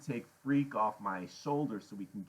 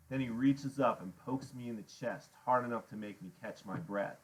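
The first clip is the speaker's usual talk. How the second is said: ordinary delivery, nothing marked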